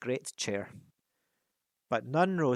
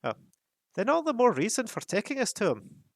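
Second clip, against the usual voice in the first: put-on much higher, louder impatient young dwarf; Fili bursting in; 10 semitones higher, 5 dB louder than usual. A narrator's reading. Fili impatient with the captain, higher and louder. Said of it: "burst in Fili".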